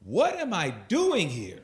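half-yelled question, pitch swooping high; retold yell. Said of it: "I just kinda yelled out".

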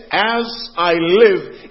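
"as I live" loud, high and drawn out; solemn, weighty stress on "as I live"; oath-like emphasis. Shown as a demonstration. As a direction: stretch each word of "as I live" like an oath. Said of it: "it sounds as if God is making".

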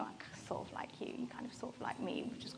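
hushed, breathy mock-secret whisper; conspiratorial whisper of a crush; whispered aside. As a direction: whisper it like a playful confession. Mimicking a flirty vibe.

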